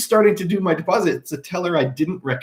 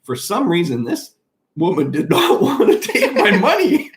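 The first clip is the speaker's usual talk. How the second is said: ordinary talk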